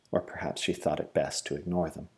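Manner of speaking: usual speaking voice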